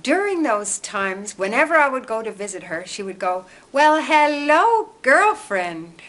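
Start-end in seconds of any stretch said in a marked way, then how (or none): 3.7-6.0 put-on high, loud and drawn-out hello, girlfriend; gushing greeting. Ex-girlfriend greeting her friend with a big, high, drawn-out hello. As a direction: stretch the hello way up with delight. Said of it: "And I always felt wonderful".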